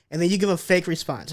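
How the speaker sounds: ordinary talk, nothing marked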